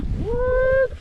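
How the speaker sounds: very high loud disbelief; amazed at the weight; shocked what. Real, not acted. Hearing the fish weight.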